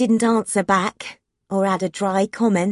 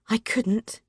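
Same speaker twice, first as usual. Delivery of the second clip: plain reading voice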